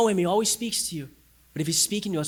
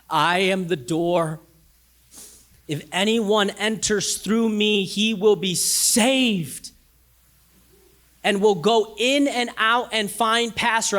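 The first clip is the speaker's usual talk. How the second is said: scripture reading; 3 semitones higher, 4 dB louder, slower than usual. Acted.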